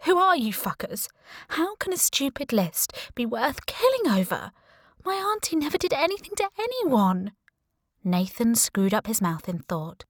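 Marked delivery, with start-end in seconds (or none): 0.0-7.3 high, furious accusation; a grieving niece lashing out; outraged shout. Acted. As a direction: hurl it at the killer. Lorna confronts her aunt's killer.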